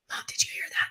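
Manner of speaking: acted hushed whisper; retold scared whispering; urgent whisper. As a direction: whisper it secretively to mom. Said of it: "Like, I'm whispering".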